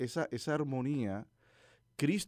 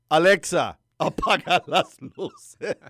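louder command; 4 semitones higher, 9 dB louder, slower than usual. Shown as a demonstration. Finally using the right wake word.

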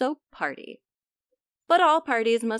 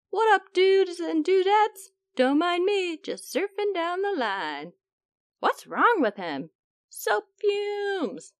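surfer dude voice; 6 semitones higher, 3 dB louder than usual. Acted. Germs surfing on bubbles.

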